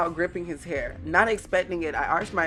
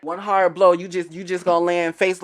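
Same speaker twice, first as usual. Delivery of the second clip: loud mocking; 3 semitones higher, 10 dB louder than usual. Real, not acted.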